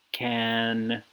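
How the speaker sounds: usual speaking voice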